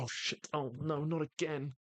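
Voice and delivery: high-pitched, flustered dismay; acted oh-no of a clumsy waiter. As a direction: voice jumps up in mock dismay. Clumsy waiter spills the tray.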